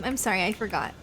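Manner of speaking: ordinary talk, nothing marked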